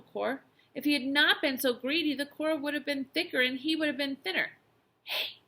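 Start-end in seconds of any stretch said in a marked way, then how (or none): 5.0-5.4 surprised whispered call; hushed, breath-only greeting. A narrator's reading. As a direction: whisper it to get someone's attention. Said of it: "whispered the boy".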